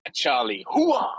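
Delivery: loud military hooah; gung-ho soldier cheer. Acted. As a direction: whoop it like a soldier. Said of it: "That was my enthusiasm".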